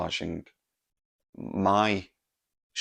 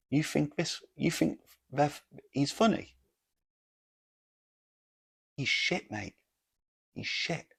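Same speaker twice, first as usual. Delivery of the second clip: higher-pitched, scornful imitation of a heckler; mock a scoffing heckler, pitched up; heckling friend; 8 semitones higher than usual. Friend runs down the comedian to nearby table, heckling. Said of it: "he goes".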